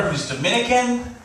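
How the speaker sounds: normal talking voice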